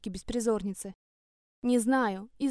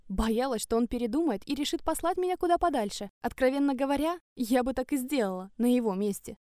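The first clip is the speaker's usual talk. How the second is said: ordinary delivery, nothing marked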